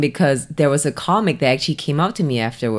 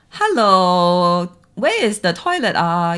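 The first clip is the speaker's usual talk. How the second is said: sing-song lilt on "Hello, where is the toilet"; 3 dB louder, much slower than usual. Shown as a demonstration. Host showing Hong Kong-like accent, sing-song "Hello, where is the toilet". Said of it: "which is very sing-song".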